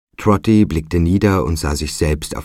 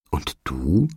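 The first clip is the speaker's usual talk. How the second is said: hesitant question with rising end; confused. A narrator's reading. Trotty questions the spirit child, mildly confused. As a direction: ask it in mild bewilderment. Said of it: "sagte Trotty verwirrt".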